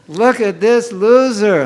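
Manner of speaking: God sighing at a hopeless devotee; mock-scornful Krishna. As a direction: look down on the lazy chanter out loud. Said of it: "he's thinking".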